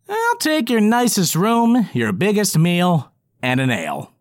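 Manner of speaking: high, slow, confident goblin order; smug goblin. Acted. Goblin character voice.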